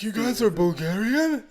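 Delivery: high, slow incredulous question; hammed-up dawning realization; mock astonishment. Stranger finds out they are Bulgarian, mock astonished.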